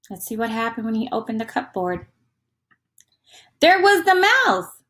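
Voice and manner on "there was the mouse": high, louder reveal of the mouse; spring the surprise on the kids; surprised reveal. Acted. Teacher revealing the mouse to children, surprised.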